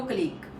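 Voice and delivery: colleague as kuh-LEEG; wrong stress on league. Shown as a demonstration. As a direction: weaken the first syllable of colleague, stress the second. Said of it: "not the correct way".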